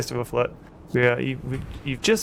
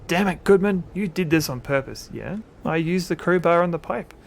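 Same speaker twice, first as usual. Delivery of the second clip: raised, angry outcry; mild howl; 6 semitones higher, 4 dB louder than usual. Acted. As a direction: start loud and high, then ease slightly. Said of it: "William Mayfield howls".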